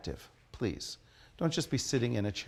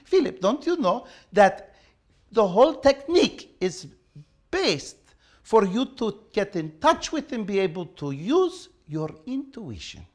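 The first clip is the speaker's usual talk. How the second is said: put-on higher, louder Russian-accented teacher voice; thick Russian accent; 6 semitones higher, 5 dB louder than usual. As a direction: play the Russian teacher scolding her student with a heavy accent. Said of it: "With her thick Russian accent".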